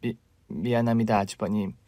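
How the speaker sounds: soft, stammering frightened sorry; wife timidly apologising to her brother-in-law; trembling apology. Acted. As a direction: falter through the apology.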